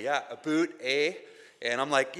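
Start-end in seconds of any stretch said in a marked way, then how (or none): 0.0-1.1 teasing imitation of a Canadian accent; mock Canadian accent. Americans teasing a Canadian about his accent, mock Canadian. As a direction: exaggerate the Canadian "a boot". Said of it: "they start laughing, and they say".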